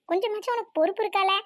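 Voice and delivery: ordinary talk, nothing marked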